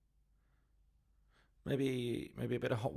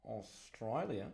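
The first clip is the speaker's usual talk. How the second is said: slow, drawn-out Aussie accent; much slower than usual. Shown as a demonstration. Painter showing Amy the allowed accent, exaggerated Aussie. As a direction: stretch it out in broad Australian. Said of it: "I can do the Aussie accent".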